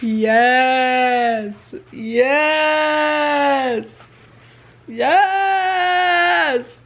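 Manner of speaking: rising triumphant yeses; cheering. Real, not acted. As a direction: celebrate the twist. Cheering plot turn.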